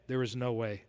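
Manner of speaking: hushed echo. Retold or imitated. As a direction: let "there is no way" fall to a near-hush. Repeats the phrase, hushed echo.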